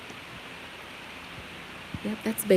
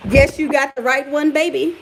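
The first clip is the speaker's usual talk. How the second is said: sassy retort; 6 semitones higher, 7 dB louder than usual. Real, not acted.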